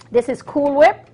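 normal talking voice

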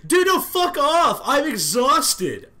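high, slow furious yell; exasperated shout. Real, not acted. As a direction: yell it out, fed up. Attacked again, exasperated.

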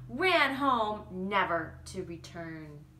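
ordinary delivery

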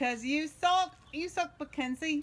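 loud, high mock-British taunt; bad British accent. Mom taunting her daughter, hammed-up British accent. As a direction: jeer in a fake British accent. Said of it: "You should just stop talking".

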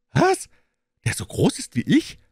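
put-on very high, astonished boy; Jungenstimme. A narrator's reading. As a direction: squeal the question like an amazed boy. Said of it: "entgegnete der Junge". (hohe, verblüffte Knabenstimme)

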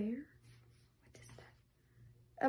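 hushed breathy whisper, very slow. Real, not acted. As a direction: whisper it as if not to disturb the animal. Whispering at a strange animal.

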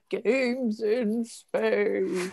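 long, drawn-out title call; mock show-title rendition. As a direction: stretch every word like a silly show intro. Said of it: "renditions of".

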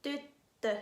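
tyttö with a whistle-like y and a held double t; Finnish y and ö. Shown as a demonstration. Teacher models tyttö for students, rounded y and ö. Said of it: "เดี๋ยวเรามาฝึกกันเลยค่ะ".

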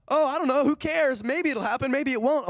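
mock carefree fool; careless shrug. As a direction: toss it off like nothing matters. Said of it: "a wise person wouldn't be like".

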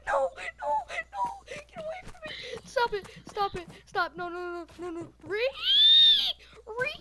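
panicked high screaming; angry crying. Real, not acted. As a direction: shriek at the attacker in terror. Caught by the chaser, screaming.

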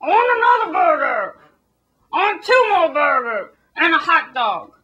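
loud, high, whining burger demands; hammed-up crying shout of a greedy American; mock American. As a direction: bawl for food like a spoilt glutton. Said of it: "Americans are all like".

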